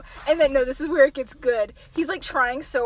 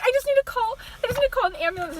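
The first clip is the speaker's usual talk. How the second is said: acted panic; 8 semitones higher, much faster than usual. Voices panicking girl over wounded boy.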